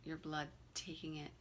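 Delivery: calm, unhurried meditation guide with long pauses; guided meditation. Real, not acted. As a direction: gently lead them through the breathing, leaving room between phrases.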